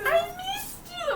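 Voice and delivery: put-on high, slow child voice; high, slow little girl saying she missed her. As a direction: drag it out like a clingy toddler.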